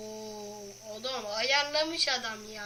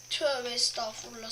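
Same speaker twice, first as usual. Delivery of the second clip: normal talking voice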